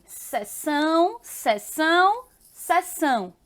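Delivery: sessão three times, slow and deliberate, as se-SÃO; pronunciation demo. Shown as a demonstration. Teacher showing pupils the homophones, sessão pronounced slowly and alike. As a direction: sound out sessão identically, rising until the last one falls. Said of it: "A pronúncia, olha".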